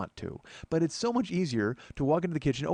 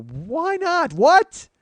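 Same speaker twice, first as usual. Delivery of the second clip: high, much louder, squealing wife; mock wife; 10 dB louder, much slower than usual. Wife reacting to his refusal to eat, high and loud. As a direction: play his baffled wife shrieking.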